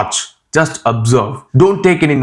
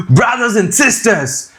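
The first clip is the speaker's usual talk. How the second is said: slow, high, exaggerated Indian accent; mock-grand Indian English address, pitched up; 10 semitones higher than usual. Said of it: "this Indian accent".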